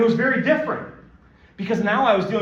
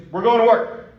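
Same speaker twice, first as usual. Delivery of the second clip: louder, higher announcement; loud declaration; 6 semitones higher, 10 dB louder than usual. Retold or imitated. Friend tells sleepy pal they're going to work, loudly. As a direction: boom it out like a boisterous buddy. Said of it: "he's a very boisterous and loud man".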